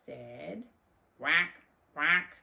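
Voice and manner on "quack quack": two loud, harsh quacks; duck quack. Acted. Dog George answering his mother, loud duck quacks. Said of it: "And George said".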